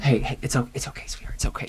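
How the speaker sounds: hushed motherly comfort; soft, breath-only soothing; soothing whisper. Acted. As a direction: whisper reassurance to a child. Mother soothing her upset child.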